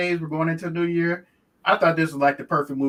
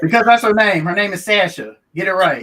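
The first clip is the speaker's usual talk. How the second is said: emphatic, raised voice; loud mock indignation; 3 semitones higher, 8 dB louder than usual. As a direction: insist loudly. Defending her name, loudly.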